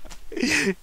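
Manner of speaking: high smug laugh; smug chuckle. Acted. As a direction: chuckle smugly. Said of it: "He chuckled with a smug grin".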